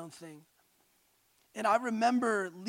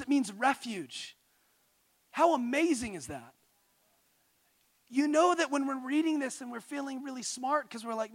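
ordinary talk, nothing marked

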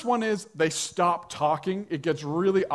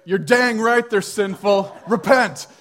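loud, raised joking condemnation; mock fire-and-brimstone rebuke; 3 semitones higher, 8 dB louder than usual. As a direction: thunder it like a mock street preacher. Joke mock condemnation, then retracted.

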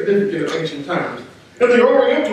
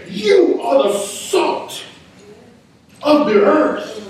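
"You" raised, then "You are the salt of the earth" slow and drawn out; slow, pointed address to the disciples; lifted "You are the salt"; 5 semitones higher, slower than usual. Shown as a demonstration. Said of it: "pointing to his followers".

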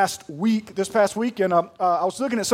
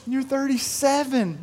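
higher, slow mock-tearful child voice; imitating crying daughter; 5 semitones higher than usual. Seven-year-old wails to her dad, mock-tearful. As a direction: play the sobbing little girl, lightly. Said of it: "uncontrollable crying".

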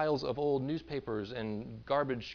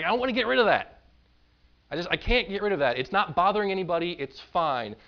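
raised, louder mock protest; defensive refusal; 5 semitones higher, 6 dB louder than usual. Sin hoarders refusing to clear out the old boxes, defensive. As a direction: protest like a hoarder clinging to junk, high and loud.

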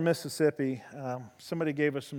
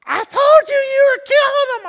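much higher, much louder mock shriek of told-you-so; accusing teenage girls; 16 dB louder than usual. Preacher voices the girls when the horse sighs, in a high accusing shriek. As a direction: shriek it as a frightened girl blaming him. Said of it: "fourteen teenage girls standing around".

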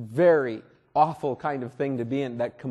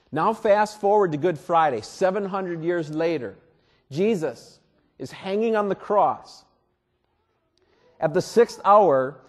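normal talking voice

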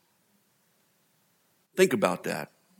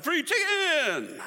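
mock excited; much slower than usual. Greedy crowd grabbing free samples.

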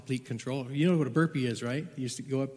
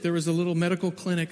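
ordinary talk

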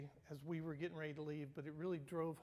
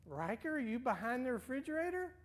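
higher, teasing seeker's voice; grandma playing along, much higher; playful calling; 9 semitones higher, 6 dB louder than usual. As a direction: sing out the question. Grandma calling hiding child.

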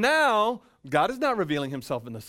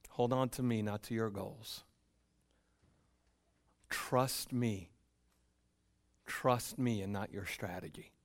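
gentle voice of Jesus; 5 semitones lower, 8 dB quieter than usual. Acted. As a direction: plead softly to hold on. Voicing Jesus' gentle plea.

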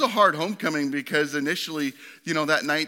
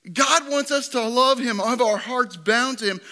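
normal talking voice

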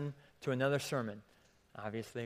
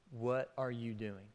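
low and much softer; soft repeat; 3 semitones lower, 7 dB quieter than usual. Shown as a demonstration. Preacher repeats God's question to congregation, softly. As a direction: let the question settle quietly.

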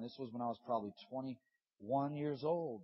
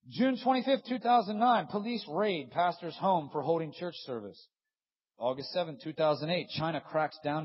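higher and louder announcement; preached headline; 4 semitones higher, 10 dB louder than usual. Acted. Preacher reading persecution headlines, loud and high. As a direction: proclaim the date like a warning.

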